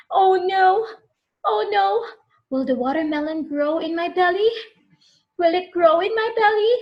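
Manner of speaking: high, loud panicky questions; worried child. Acted. Connor fears a watermelon in his belly, panicky. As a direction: fret like a scared little boy. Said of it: "Connor wondered".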